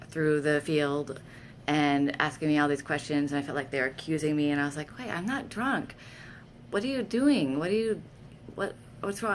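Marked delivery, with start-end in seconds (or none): 5.0-9.3 high, alarmed pleading to the cops; alarmed protest. Retold or imitated. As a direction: insist you're not drunk, rising panic.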